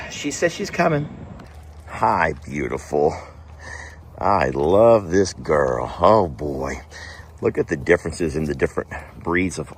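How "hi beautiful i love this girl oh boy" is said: low, slow and tender; doting on the dog. Real, not acted. Greeting the dog on the floor, low tender coo.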